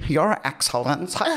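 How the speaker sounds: rising, awed American fan voice; mock American accent. Host re-quoting American fans, mock American accent. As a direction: gush it like a starstruck fan. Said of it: "so it's so funny".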